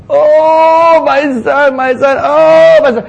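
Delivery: high, loud self-pitying cry; mocking wail. Preacher mocking David's self-pity, high loud wail. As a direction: overplay the grief to show self-pity. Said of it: "David was experiencing? Self-pity".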